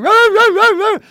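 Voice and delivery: loud, high yapping barks; dog bark imitation. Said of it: "the dog's barking".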